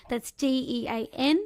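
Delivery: ordinary talk, nothing marked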